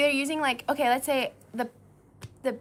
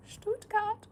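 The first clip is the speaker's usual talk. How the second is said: put-on German accent; 10 semitones higher, 4 dB quieter than usual. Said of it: "I can do a German accent too".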